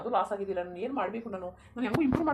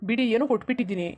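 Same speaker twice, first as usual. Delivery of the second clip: loud protest; 9 dB louder than usual. Acted. Grandson defends himself to grandmother.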